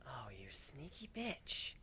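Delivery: soft slow whisper; murmured. Acted. Kuno murmuring about the queen, slow whisper. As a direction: breathe it under your breath. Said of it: "she murmured".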